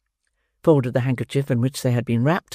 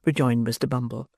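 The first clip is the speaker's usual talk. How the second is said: plain reading voice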